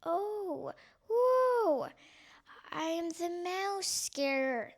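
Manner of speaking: high far hoot, spooky owlish voice; spooky hooting scarer. Acted.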